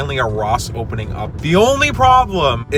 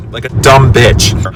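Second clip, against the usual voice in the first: loud; 11 dB louder, slower than usual. Real, not acted. Self-mocking outburst.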